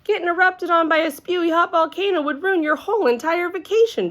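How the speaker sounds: put-on high-pitched kid hollering in protest; outraged little-girl holler, pitched well up. A narrator's reading. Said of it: "she hollered".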